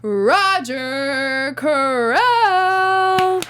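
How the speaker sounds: slow, drawn-out ring announcer call; [name]'s wrestler announcer voice. Acted. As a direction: stretch the name out like a ring announcer. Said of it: "uh, wrestler announcer voice".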